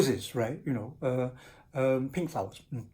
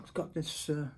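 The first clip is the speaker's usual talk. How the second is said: ordinary delivery, nothing marked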